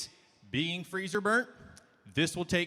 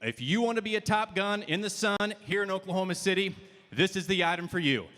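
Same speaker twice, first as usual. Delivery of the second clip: ordinary talk, nothing marked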